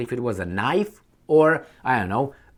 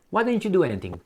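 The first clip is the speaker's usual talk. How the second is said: ordinary talk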